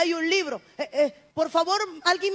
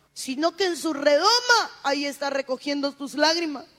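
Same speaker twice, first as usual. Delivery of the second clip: ordinary delivery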